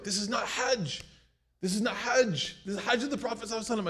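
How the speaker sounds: mock outrage over the lobster; upset brother. Angry young brother complaining that this is not Hajj. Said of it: "He was really upset".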